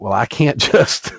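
laughing through the words. Real, not acted. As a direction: chuckle while saying it. Laughing while retelling own reply.

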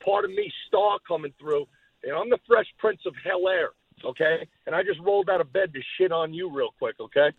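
brash trash-talking promo; cocky heel promo; wrestling promo. Acted. Enzo cutting a promo.

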